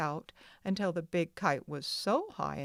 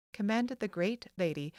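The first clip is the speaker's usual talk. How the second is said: ordinary delivery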